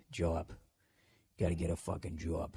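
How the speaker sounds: "job" with the vowel of "jaw", then a b; low, quiet Boston-accented "job". Shown as a demonstration. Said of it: "J O B, just say, like, jaw".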